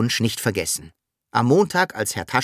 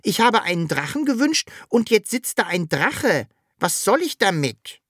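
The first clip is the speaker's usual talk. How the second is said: high-pitched little creature protest; complaining call; 10 semitones higher than usual. A narrator's reading. Sams complaining to the wishing machine, high protesting call. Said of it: "rief das Sams zur Wunschmaschine hoch".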